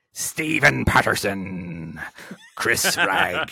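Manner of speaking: slow, drawn-out pirate roll call. Pretend. Host thanking patrons by name in a pirate voice. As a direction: read each name like a hammed-up pirate. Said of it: "in a pirate voice".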